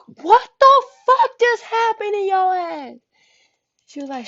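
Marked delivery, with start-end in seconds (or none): 0.1-3.0 loud, very high-pitched disbelief; retold high-pitched yell of shock; shocked outburst. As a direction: exclaim in hammed-up horror. Retold shock at her bruises.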